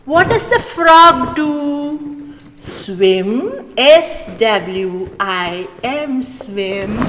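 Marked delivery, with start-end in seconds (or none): none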